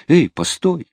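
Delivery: high-pitched shout after someone; calling out. A narrator's reading. Sailor running after the departing girl, calling out. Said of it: "крикнул он".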